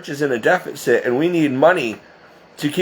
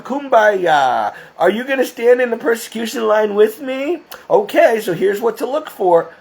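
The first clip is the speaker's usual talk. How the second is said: higher, hammed-up sugary happy voice, giggly; mock bubbly cheer; 7 semitones higher than usual. Mocking chipper video host telling viewers good news, higher and hammed-up. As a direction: parody a chirpy upbeat preacher, all fake sunshine. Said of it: "I'm not gonna sit there and say".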